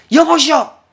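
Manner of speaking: "여보시오!" yelled high and loud with contempt; rude angry shout. Shown as a demonstration. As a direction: bark it at the father like a stranger. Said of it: "이렇게 할 수는 없는 거죠".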